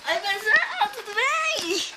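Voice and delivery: very high, drawn-out posh greeting; rich kid voice. Acted. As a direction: squeal it like a spoiled rich kid.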